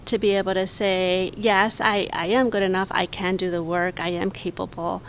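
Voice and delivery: ordinary delivery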